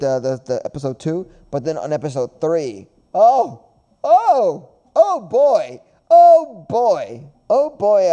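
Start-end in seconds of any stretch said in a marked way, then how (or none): 3.1-8.1 loud, high bursts of awe; excited. Real, not acted. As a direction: gush with rising excitement. Hyping up episode three.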